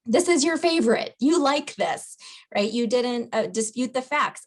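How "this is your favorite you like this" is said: higher, louder insistent coaxing; pushy convincing. Shown as a demonstration. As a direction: push them to accept it. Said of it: "You didn't try to convince them".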